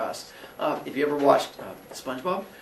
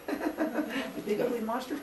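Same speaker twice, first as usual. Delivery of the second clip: high, soft, slow teasing voice; slow mocking voice; 8 semitones higher, 9 dB quieter, slower than usual. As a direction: drag out the insult sweetly. Teasing sibling punchline.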